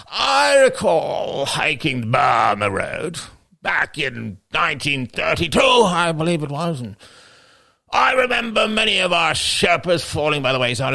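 loud, slow, high colonel voice; old British officer. Acted. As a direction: boom it like a pompous old explorer.

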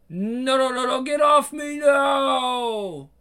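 high terrified cry of no; desperate pleading shriek; panicked screaming. Acted. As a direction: scream as you are overpowered. Being attacked in a fight.

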